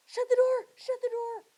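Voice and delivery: high, loud, urgent "shut the door"; a sick boy pleading with his cousin in a high, loud panic; frantic plea. Acted. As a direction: beg her to close it before anyone hears.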